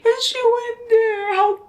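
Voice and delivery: very high, teary coo; high-pitched melting squeal of affection; tearful gushing. Real, not acted. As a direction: gush over something cute, near tears. Gushing tearfully over the book.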